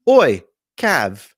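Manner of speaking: loud, hammed-up British "Oi"; jokey British impression, shouted; mock British accent. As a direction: call out in a put-on British accent. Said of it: "Any, any British listeners we just had".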